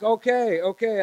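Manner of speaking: high, loud retold panicky okays; freaking out. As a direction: re-enact yourself trying not to panic. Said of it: "And I'm freaking out".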